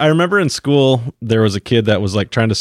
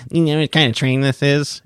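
put-on know-it-all kid voice; a hint of a whiny know-it-all kid; nerd kid voice; 4 semitones higher than usual. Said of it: "my annoying nerd voice".

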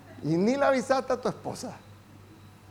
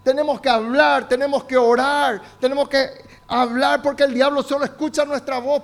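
louder, driving sermon voice; fervent preaching; 5 semitones higher, 6 dB louder than usual. Acted. Pastor urges the church to pray aloud, fervent and louder. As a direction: preach it out with conviction.

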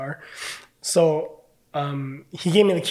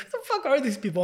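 high-pitched confused disbelief; baffled; 9 semitones higher, faster than usual. Retold or imitated. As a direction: pitch way up, totally lost. Confused by DM reactions.

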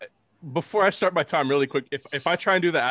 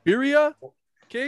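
excited food praise; 10 semitones higher, 5 dB louder than usual. Real, not acted.

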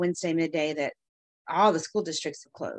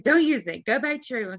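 scolding mom; 6 semitones higher, 4 dB louder than usual. Retold or imitated.

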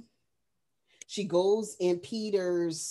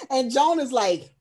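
ordinary talk

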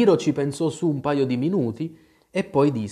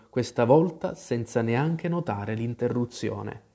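plain reading voice

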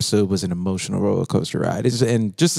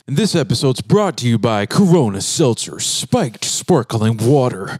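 mock ad read; 2 semitones higher, slower than usual.

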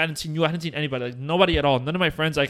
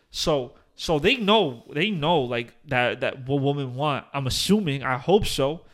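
ordinary talk, nothing marked

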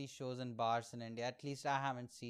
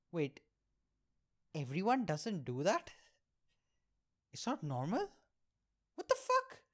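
shocked realization; 4 semitones higher than usual. Retold or imitated. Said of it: "I was like".

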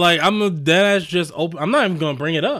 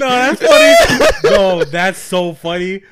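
slow, laughing through the words; can barely talk for laughing; 3 semitones higher, 9 dB louder, slower than usual. Real, not acted. Friend cracking up at the joke, slow and laughing.

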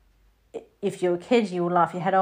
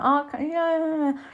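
mock surprise; 9 semitones higher than usual. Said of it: "I was like".

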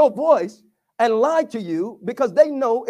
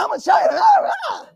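mock rage. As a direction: fire it back like a provoked parent. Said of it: "I'm gonna show you my rage".

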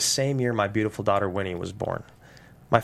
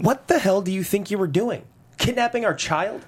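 angry; 7 semitones higher than usual. Acted. Confronting kidnappers.

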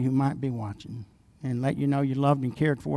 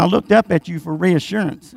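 ordinary talk, nothing marked

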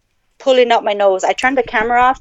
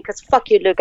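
ordinary talk, nothing marked

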